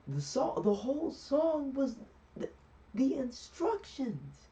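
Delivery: very high, drawn-out mock protest; exasperated genie. Genie telling Aladdin the song explained it all, in high exasperation. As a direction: stretch each word out high in comic disbelief.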